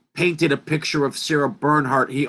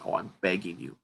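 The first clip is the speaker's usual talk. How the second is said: quiet plea; 6 semitones lower, 13 dB quieter, faster than usual. Real, not acted. Pleading audience to listen.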